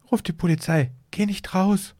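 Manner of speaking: urgent and scared, voice climbing; shaky voice. A narrator's reading. Wife begging her husband not to go out, shaky voice. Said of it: "sagte sie mit zittriger Stimme".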